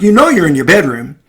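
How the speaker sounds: mock scolding parent; shaming tone. Shown as a demonstration. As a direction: sneer it like it's obvious. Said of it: "cannot be in a dismissive or a shaming way".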